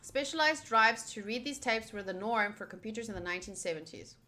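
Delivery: usual speaking voice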